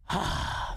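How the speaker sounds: heavy exhale after ranting; exasperated sigh. Real, not acted. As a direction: let out a spent sigh. Spent after a long rant.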